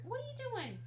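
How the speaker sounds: asking the cat in a high pet voice; pet talk. Real, not acted. Talking to the cat.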